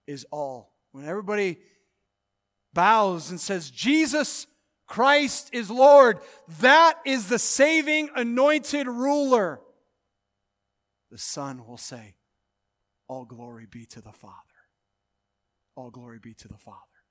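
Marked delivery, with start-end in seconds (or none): 3.7-9.6 slow, loud, drawn-out declaration; booming confession; slow proclamation. Acted. Voicing every tongue confessing. 13.1-17.0 whispered, much softer and lower; hushed whispered praise; reverent whisper. Acted. Son voiced in awe.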